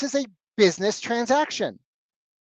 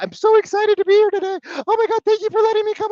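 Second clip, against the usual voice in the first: much higher and louder, gushing and eager; happy puppy voice; 6 dB louder than usual. Shown as a demonstration. Coach mocking eager agents to clients, squealing gush. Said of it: "Stop sounding like a happy puppy".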